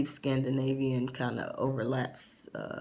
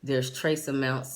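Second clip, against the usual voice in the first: ordinary talk, nothing marked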